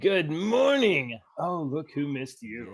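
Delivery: slow, drawn-out sing-song greeting. Real, not acted. Co-host greets a child who just appeared, cooing. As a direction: coo it slowly to a little one.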